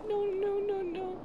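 high, small string of refusals; frightened whimper. Real, not acted. Dreading what is ahead.

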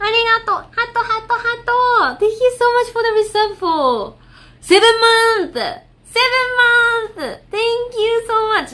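high, bright-pitched thanks to chat; high streamer shout-out; streamer thank-you voice. Real, not acted. Thanking viewers for resubs.

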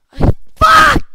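high, very loud shout of rage; furious shout. Real, not acted. Gamer shouting after another fall. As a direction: scream it at the screen.

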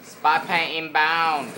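mock Vietnamese accent. Gamer echoing the spy plane announcer, loud mock Vietnamese accent. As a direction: imitate the game announcer in a drawn-out Vietnamese accent. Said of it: "I talk like this".

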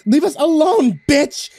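high, loud furious scream. Acted. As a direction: scream at the monster. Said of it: "I heard Emily scream".

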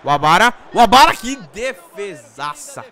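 loud, stretched amazement; excited. Real, not acted. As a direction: cheer the save. Reacting to great save.

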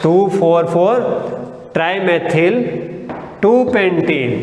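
full name drawn out slowly, part by part; slow dictation. Shown as a demonstration. Teacher giving the corrected compound name, slow and deliberate. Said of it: "बस ये change कर दिया".

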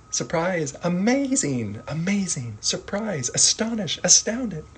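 high, wide-eyed amazement; amazed. Shown as a demonstration. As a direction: say each word in awe. Review with acted surprise.

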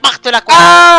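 sudden loud threat; villain yells mid-threat; villain shout. Acted. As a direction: bellow the threat.

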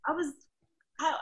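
ordinary talk

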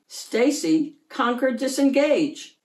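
ordinary delivery

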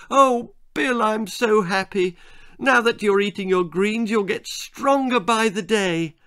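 high, gushing delight; overjoyed mother. A narrator's reading. Mother to Bill now eating his greens, overjoyed. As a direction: gush with joy, pitched right up.

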